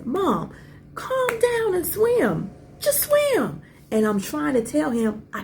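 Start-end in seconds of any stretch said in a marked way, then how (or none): 0.0-3.6 high, slow, urgent calling out; son's urgent call. Retold or imitated. Son urging his panicking mom in the water, high urgent call. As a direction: call to her across the water, pleading her to swim.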